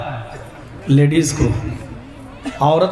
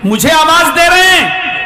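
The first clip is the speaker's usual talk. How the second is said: surprised man; 7 semitones higher, 4 dB louder than usual. Acted. Man surprised being called.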